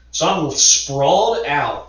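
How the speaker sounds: normal talking voice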